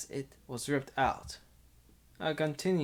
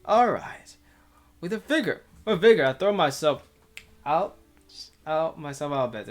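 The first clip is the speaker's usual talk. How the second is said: energetic; 3 semitones higher, 9 dB louder than usual. Acted. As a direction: throw yourself into it. Hero leaps up energetically.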